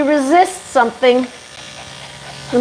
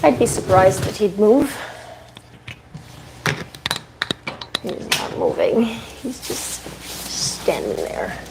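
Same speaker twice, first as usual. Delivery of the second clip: muttered aside; 7 dB quieter than usual. Real, not acted. Muttering while tying dog.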